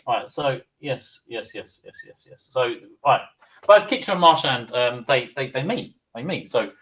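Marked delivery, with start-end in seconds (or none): 0.8-2.5 yeses trailing off to a whisper; half-whispered repeated yes; fading mumble. Real, not acted. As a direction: mumble to yourself until it dies away. Muttering to herself, fading out.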